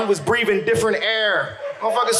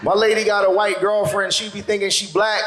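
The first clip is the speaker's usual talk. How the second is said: ordinary delivery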